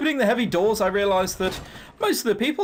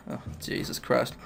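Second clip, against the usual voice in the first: whispered aside under the breath, much softer; exasperated whisper; 8 semitones lower, 7 dB quieter than usual. Real, not acted. Player reacting to the sermon news, whispered dismay. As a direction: mutter it to yourself in dismay.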